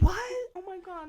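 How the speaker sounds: very high, loud alarmed what; shocked disbelief. Retold or imitated. Driver learning about the robbery, high shocked cry. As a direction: gasp it out in shock.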